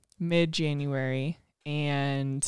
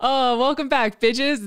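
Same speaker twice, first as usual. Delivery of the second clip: bright, loud show opener; excited greeting; 7 semitones higher, 4 dB louder, slower than usual. Acted. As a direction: greet the listeners with a big lift. Podcast intro greeting.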